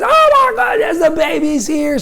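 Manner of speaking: high-pitched excited outburst; panicked new dad shouting the news; all over the place, voice shooting way up; frantic excitement. Retold or imitated. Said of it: "like, all over the place".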